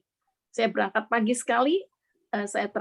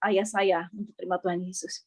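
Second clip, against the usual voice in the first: ordinary delivery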